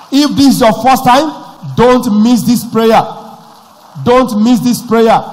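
loud, slow pleading; urgent plea. Acted. Pastor pleading with first-time visitors, loud and slow. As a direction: drag it out to press them.

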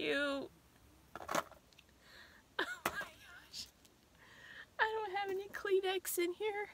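high, quiet, tearful voice; fighting back tears, voice cracking; choked up. Real, not acted. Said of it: "Don't make me cry".

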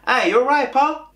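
high, loud Manchester greeting; Manchester accent. Retold or imitated. Coworker greets him on his first day at work, Manchester accent. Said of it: "Voy a intentar poner el acento que tienen allí en Manchester".

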